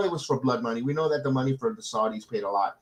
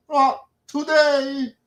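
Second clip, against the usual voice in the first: much higher, slow, stretched-out "Not today"; drawn-out high call; 12 semitones higher, 3 dB louder, much slower than usual. Acted. Repeated call, pushed much higher.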